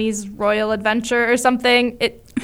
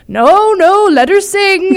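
high, loud mock protest; acted intervening bigwig; 9 semitones higher, 6 dB louder, slower than usual. Co-host acts out Annie's defender chiming in, mock protest. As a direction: cut in over the room, playfully acted. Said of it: "Oliver Warbucks chimes in".

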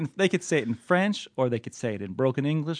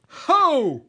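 surprised whoop; 13 semitones higher, 4 dB louder than usual. Real, not acted. Reacting to the quoted line.

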